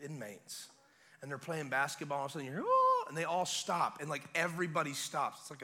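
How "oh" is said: high, loud sung "oh"; imitated opera note. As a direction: mimic soaring music bursting out. Imitates music suddenly heard over speakers.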